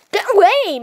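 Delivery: high, loud lady-like get away; lady-like shriek. Acted. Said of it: "Why do you sound like a lady?".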